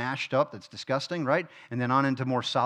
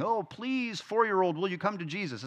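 mock begging; 4 semitones higher, 4 dB quieter than usual. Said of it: "not in the sense of begging".

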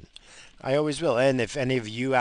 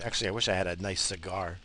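ordinary talk, nothing marked

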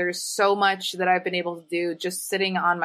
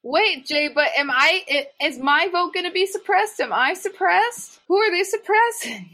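mock alarm; 7 semitones higher than usual. Mock alarm about her vote.

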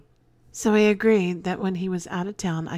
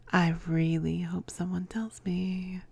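whispering; 3 dB quieter than usual. Real, not acted. Teasing aside.

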